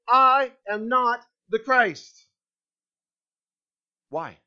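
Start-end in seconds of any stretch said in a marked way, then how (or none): 0.1-2.3 "I am not the Christ" loud and slow, in spaced pieces; slow, forceful "I", then a firm "not the Christ"; unequivocal, emphatic. Acted. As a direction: state it with no doubt, hitting "I" and "not". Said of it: "He unequivocally, emphatically states".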